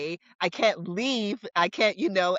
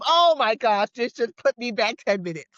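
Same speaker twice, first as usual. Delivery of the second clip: exasperated; 4 semitones higher, 5 dB louder than usual. Retold or imitated. Toddler delays departure.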